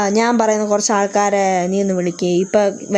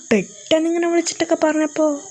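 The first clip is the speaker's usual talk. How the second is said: flustered; 7 semitones higher than usual. Acted. Son flustered, high other voice.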